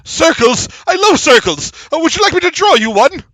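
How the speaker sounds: high, loud, over-keen halfling; eager halfling. Acted. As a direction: gush eagerly to dodge the question. Halfling dodges the question eagerly.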